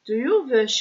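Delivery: put-on light Russian accent. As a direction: ask with a Russian lilt. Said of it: "I'm putting in the Russian accent".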